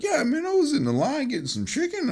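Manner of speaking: high-pitched imitation of a Louisiana accent; hammed-up Southern accent. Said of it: "let's say Southern accent".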